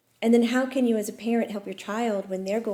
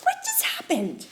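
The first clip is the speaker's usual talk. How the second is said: stunned. Retold or imitated. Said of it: "I'm like".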